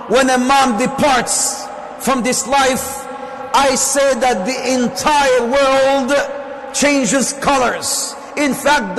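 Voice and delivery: preaching. Acted. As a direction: preach it to the hall, each line landing hard. Preacher addresses congregation about mothers.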